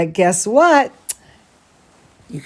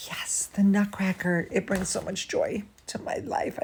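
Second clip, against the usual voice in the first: whispering; 11 dB quieter than usual. Real, not acted. Hushed delight over Nutcracker.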